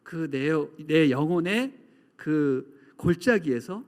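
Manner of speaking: usual speaking voice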